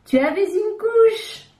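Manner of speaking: high, slow sing-song mockery; little hens mocking Coco's baby photo; teasing. A narrator's reading. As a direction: taunt a baby sister lightly. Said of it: "Ce n'est pas gentil de se moquer".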